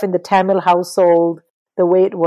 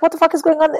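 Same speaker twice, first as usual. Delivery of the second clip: amazed disbelief; 9 semitones higher, faster than usual. Retold or imitated. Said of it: "I was like".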